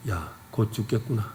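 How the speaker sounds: low, hushed resignation; understated dismay, sinking low and quiet; acted dread. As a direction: voice a doomed captive's thought, very softly. Voices doomed captives' thoughts.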